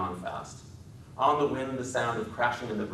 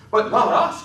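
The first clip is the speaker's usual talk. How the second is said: high, loud happy cry; a child's delight; cried happily; 12 dB louder than usual. A narrator's reading. Lenny happily joining George's story, high and loud. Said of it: "cried Lenny happily".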